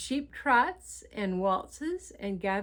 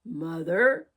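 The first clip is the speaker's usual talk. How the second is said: louder, lower growling exclamation; a mild wolf growl calling Mother; a lightly voiced wolf exclaiming; low growly wolf; 3 semitones lower, 7 dB louder than usual. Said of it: "In a low, growly voice, he exclaimed".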